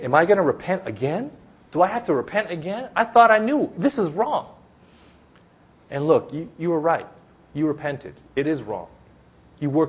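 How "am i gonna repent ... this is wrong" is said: higher-pitched, incredulous inner protest; exasperated self-questioning. Acted. Believer who stumbled questions repenting again, exasperated. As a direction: pitch climbing as if baffled at yourself. Acting out a frustrated believer's doubt.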